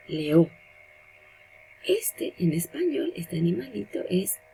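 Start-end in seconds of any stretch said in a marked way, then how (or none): 0.1-0.5 "leó" con la ó final acentuada y alargada; acento final en guaraní. Shown as a demonstration. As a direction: di "leó" cargando la última sílaba. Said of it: "En guaraní sería". ("leó" con acento en la ó final)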